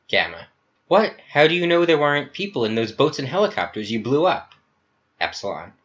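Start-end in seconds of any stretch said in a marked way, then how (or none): 0.9-4.5 much higher, loud disbelief; shocked accusation. Acted. Gamma accusing Epsilon of killing people, higher and louder. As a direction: burst out in horrified disbelief.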